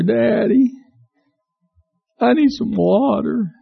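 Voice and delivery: put-on little boy whining for water; whiny little boy. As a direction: stretch it out like a tired child.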